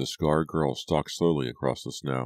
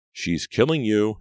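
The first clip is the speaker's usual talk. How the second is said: high-pitched urgent alarm; inner scream; 7 semitones higher, 4 dB louder than usual. A narrator's reading. Brady's mind warning him, screaming. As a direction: cry it out as a desperate warning. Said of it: "his mind screamed".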